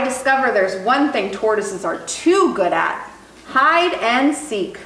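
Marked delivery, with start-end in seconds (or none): none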